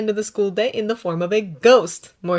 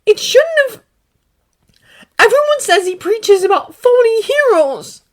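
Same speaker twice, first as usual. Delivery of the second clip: much higher and louder, bitter; spat out; 11 dB louder than usual. A narrator's reading. Izuku spitting bitter words to All Might. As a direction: spit the words out. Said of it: "spits them out".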